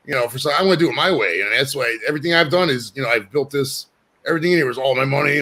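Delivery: normal talking voice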